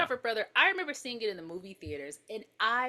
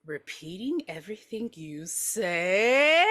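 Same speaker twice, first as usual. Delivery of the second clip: slow, mocking drawn-out echo; condescending singsong repeat; talking to a toddler; 4 semitones lower, much slower than usual. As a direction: stretch it out patronizingly, swelling on 'say'. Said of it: "repeats her like she's two years old".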